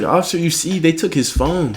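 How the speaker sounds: high-pitched indignant mom voice; mad mom. Retold or imitated. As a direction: imitate a mother angrily taking her son's side. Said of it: "my mom's mad as hell".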